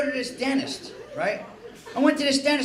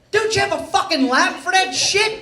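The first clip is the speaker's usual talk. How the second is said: loud, high, incredulous demand; indignant outburst; 8 semitones higher, 5 dB louder than usual. Retold or imitated. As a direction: challenge the dentist in mock outrage. Indignant confrontation with the dentist.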